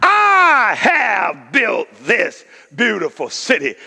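slow, proud shout; boastful king. Acted. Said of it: "here's arrogance, blatant".

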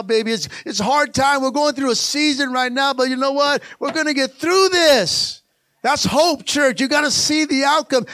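high, loud preacher building to a shout; full-force preaching. Real, not acted. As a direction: drive it up to a sustained, high-pitched cry to the church.

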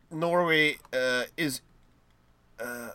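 normal talking voice

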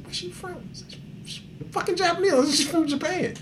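high, soft quoted back-and-forth; retelling a friend's eager questions, high and soft; excited quoting. Retold or imitated. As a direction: pitch the quoted lines up high but keep them soft. Friend and teller trading lines.

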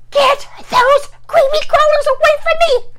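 high, loud terrified scream. Acted. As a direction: scream it out in panic. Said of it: "As she screamed".

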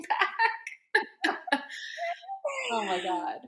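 high, breaking laugh on the word; real laughter. Real, not acted. Host laughing about being watched from first class, real laughter. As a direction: let the word dissolve into laughter.

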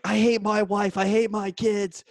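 loud, high hysterical rant; mock outburst. Imitates spouses blowing up during prank call.

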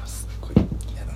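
real whispered grumble; small voice. Real, not acted. Teased performer admits real dislike, quick whisper. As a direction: say it under your breath, quickly. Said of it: "ちっちゃい声で言ったらガチなんよ".

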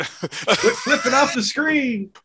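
high, slow, laughing scold. Pretend. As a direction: scold through giggles. Mock grandpa bit.

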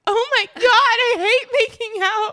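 high, drawn-out mock whine of disgust. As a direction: drag it out high like you're grossed out. Retelling first kiss reaction.

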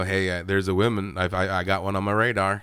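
low, understated talking-dog voice; a low-key heads-up, lightly imitating the dog; lightly voiced dog. Dog tipping off his companion about a woman, low and casual. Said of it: "the dog, like, you know".

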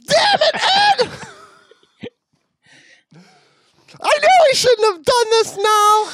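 very high, loud hammed-up drowning wail; pretend choking on salt water. Diver pretending to breathe salt water and cursing Ed, mock panicked shriek. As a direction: shriek it as if gurgling seawater, playing at panic. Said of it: "pretend to breathe salt water".

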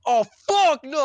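loud, high outraged yell; screaming rant. Retold or imitated. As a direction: explode from zero to one hundred, imitating an angry man. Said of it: "just screaming and ranting".

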